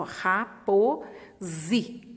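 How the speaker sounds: ra, po, zi spoken as separate beats; teacher showing how the syllables split; sounding out syllables. Shown as a demonstration. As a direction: sound out each syllable for the child. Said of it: "ó".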